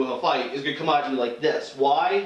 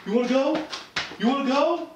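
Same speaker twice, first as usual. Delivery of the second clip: higher, taunting "you wanna go"; drunk bro challenge; 9 semitones higher than usual. Retold or imitated. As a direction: egg on a fight like a drunk at a bar. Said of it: "drunk people a lot".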